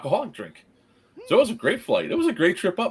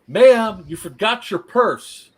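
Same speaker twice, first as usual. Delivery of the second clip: loud, high call from the back of the plane; loud mock-helpful call down the aisle; 6 semitones higher, 7 dB louder, slower than usual. Man calls down the plane aisle.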